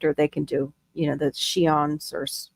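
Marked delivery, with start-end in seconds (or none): 1.3-2.1 "scions" said as SHEE-onz; unsure pronunciation. Shown as a demonstration. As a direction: start "scions" with a sh sound. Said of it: "I forget how they say it".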